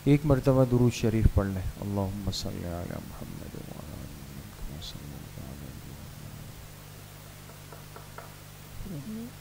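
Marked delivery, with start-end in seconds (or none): 1.8-9.3 soft, low prayer murmur under the breath; murmured recitation. Acted. Teacher leading students in quiet recitation, murmured softly. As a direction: recite it quietly to yourself, inward.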